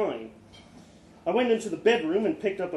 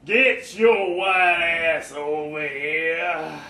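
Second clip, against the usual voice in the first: very slow, louder, laughing rasp; Charles's raspy voice; 7 dB louder, much slower than usual. A narrator's reading. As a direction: growl the order with a chuckle. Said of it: "in that legendary raspy voice".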